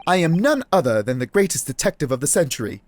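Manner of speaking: grand British detective introducing himself; amateur British accent, boastful; terrible British accent. Acted. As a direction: announce yourself with hammed-up British pomp. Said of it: "I have a terrible British accent".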